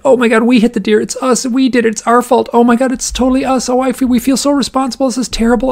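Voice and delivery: high-pitched, loud, frantic guilty wailing; crying hysterically. Retold or imitated. Couple who hit the deer, wailing in guilty panic. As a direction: imitate a couple panicking and sobbing over their fault. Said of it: "Oh, and they're crying".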